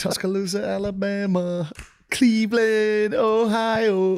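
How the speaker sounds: high, slow mock rocker calling out to the crowd; hammed-up band frontman greeting cities; rock singer. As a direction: announce the tour stops like a rock star. Parodies band shouting to crowds.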